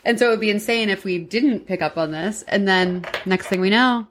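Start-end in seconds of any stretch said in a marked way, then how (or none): none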